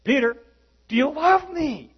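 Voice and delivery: high, loud repeated question; pressing Jesus. Retold or imitated. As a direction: push the question harder. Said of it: "He says".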